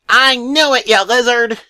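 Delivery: high, loud furious cry; shouted. Acted. Dale shouting at John Redcorn, high and furious. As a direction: scream it at him, high and loud. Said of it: "Dale shouted back".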